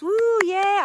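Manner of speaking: high, loud cheering whoop; mock cheer. Everyone clapping for the live stream, mock cheering. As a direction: cheer like a crowd of fans, half-joking. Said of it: "And then everyone claps".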